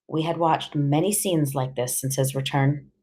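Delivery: plain reading voice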